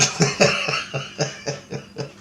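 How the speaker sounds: breathy, mostly voiceless chuckle; a real wheezy laugh to himself. Real, not acted. Laughing at his own story of reality ending, breathy chuckle.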